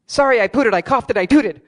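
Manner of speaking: mock-innocent apology rhyme; loud sheepish rhyme. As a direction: blurt a silly rhyme to cover embarrassment. Blurted rhyming excuse for gas.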